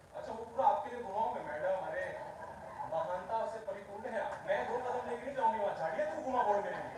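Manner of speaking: fast, loud sarcastic imitation; mock diva. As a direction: rattle off a snooty lady's demands. Mocking an audience member's demand.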